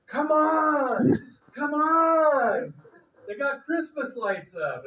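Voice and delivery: high, loud, eager calling; excited cousin. Retold or imitated. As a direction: pull him out to see the lights, thrilled. Cousin eagerly calling him outside.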